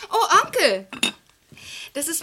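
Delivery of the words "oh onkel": bright, louder, higher call to her uncle; excited greeting. Acted. Niece greets her uncle loudly and gladly as he arrives. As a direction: call out happily as he walks in.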